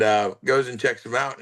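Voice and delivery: ordinary delivery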